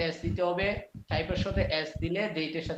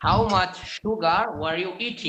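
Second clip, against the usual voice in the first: slow model reading; 4 dB louder, much slower than usual. Shown as a demonstration. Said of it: "teacher models sentence slowly".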